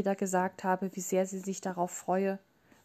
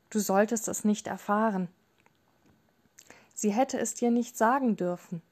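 ordinary delivery

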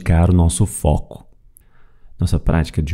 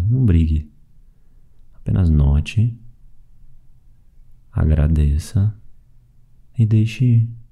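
calm, even meditation voice with long pauses; guided meditation. Acted. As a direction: guide them gently through the breathing, leaving space after each phrase. (meditação guiada, voz calma e pausada)

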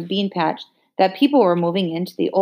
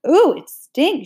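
disgusted; 9 semitones higher, 4 dB louder, slower than usual. A narrator's reading. Girl disgusted by sweaty shirt.

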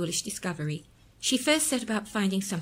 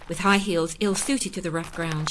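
ordinary delivery

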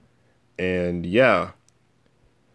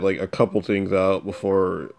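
normal talking voice

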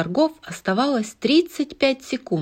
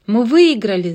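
loud happy cheer; joyful shout; 3 semitones higher, 7 dB louder than usual. Acted. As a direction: yell with joy. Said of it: "завопила от радости".

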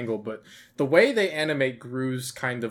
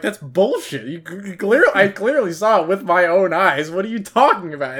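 mock outrage, higher and louder; indignant protest; 6 semitones higher, 7 dB louder than usual. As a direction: imitate an indignant customer disputing a call. Acting out Gru disputing the game.

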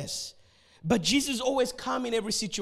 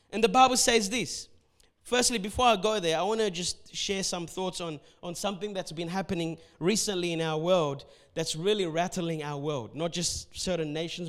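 usual speaking voice

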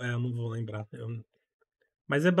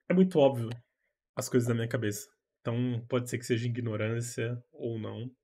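ordinary talk

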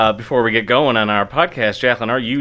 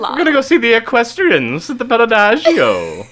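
much higher, louder snooty society voice; mock fancy socialite; 10 semitones higher, 4 dB louder than usual. Host mocking a fancy couple's evening plans as a snooty socialite. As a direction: put on airs about a night out.

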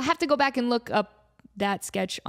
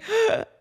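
excited gasp; 12 semitones higher, 3 dB louder than usual. Retold or imitated. Hearing Amanda on speakerphone.

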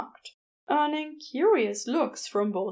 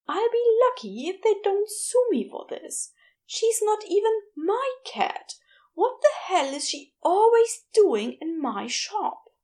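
higher-pitched fed-up complaining; exasperated rant; 10 semitones higher than usual. Acted. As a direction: rant while pacing about the stray cat. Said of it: "Gabriel said, pacing".

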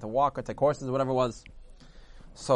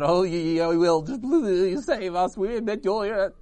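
loud, high, frantic pleading; hammed-up frightened pleading; panicked begging; 6 semitones higher, 6 dB louder, slower than usual. A narrator's reading. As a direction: babble desperately to be saved. Terrified body parts beg the tongue.

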